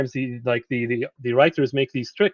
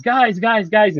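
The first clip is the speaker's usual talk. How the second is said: much higher, upbeat mock call of guys; casual California guys; 8 semitones higher, 5 dB louder, much slower than usual. A translator mocking a too-casual English version, breezy. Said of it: "unless he's from California".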